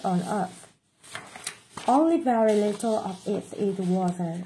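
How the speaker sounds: mother and child skit, voiced; dialogue read aloud. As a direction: read the little dialogue like a kids' audio drill. Played dialogue of mother and Victor.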